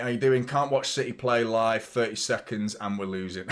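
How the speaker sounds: normal talking voice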